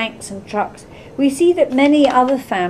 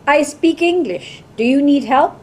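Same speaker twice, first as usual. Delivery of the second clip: calling out; 4 semitones higher than usual. A narrator's reading. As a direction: call it out with measured clarity. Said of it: "a female voice calls out".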